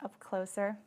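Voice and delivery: calm Yoga Nidra leading, phrase by phrase; guided meditation. Acted. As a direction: guide them gently into deep relaxation. Leads guided relaxation practice.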